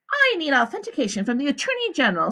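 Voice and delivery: a high, smooth woman's voice; the warden. A narrator's reading. The warden explains to the visitors, high and smooth. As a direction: lie calmly to the visitors.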